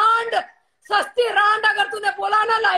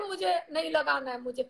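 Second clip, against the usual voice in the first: soft, lowered retold answer; subdued reply; 5 semitones lower, 9 dB quieter than usual. Mother answering her son in a retold talk, subdued. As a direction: drop low and quiet, as if confiding.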